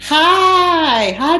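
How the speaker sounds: ordinary talk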